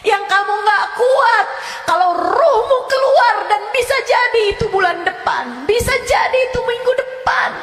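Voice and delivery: loud, high, shouting warning; fiery preaching. Acted. Ustazah warning her listeners of torment in the grave, shouting.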